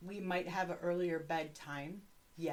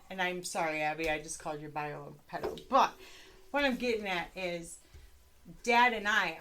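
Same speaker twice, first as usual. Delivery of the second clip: normal talking voice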